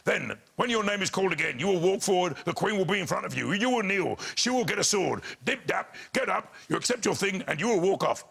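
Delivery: mimicked usher drilling the procedure, pitch lifted; backstage official briefing. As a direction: reel off the orders in crisp, even commands. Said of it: "backstage they go".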